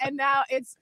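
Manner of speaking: ordinary talk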